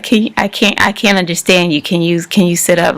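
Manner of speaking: usual speaking voice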